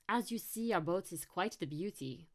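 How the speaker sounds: put-on French-accented tour guide; proud French-accented patter; Mathieu. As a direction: show off the boat in a thick French accent. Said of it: "he said in a thick French accent".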